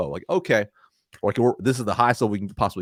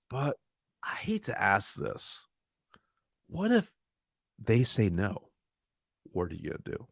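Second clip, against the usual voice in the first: reluctant, trust-building; 7 dB quieter than usual. Shown as a demonstration. Coach showing how to ask a client a painful question, reluctant and soft. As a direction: ease gently and haltingly into a hard question. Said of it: "the shifting of what I'm doing here".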